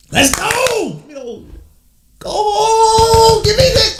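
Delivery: high, excited fan cheer; pumped-up celebratory shout; hyped yelling. Real, not acted. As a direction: holler it as your team scores. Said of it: "I just be hyped".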